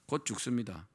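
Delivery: ordinary talk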